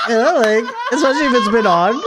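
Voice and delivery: high and laughing. Real, not acted. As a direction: laugh through the words. Laughing while describing the Band-Aid.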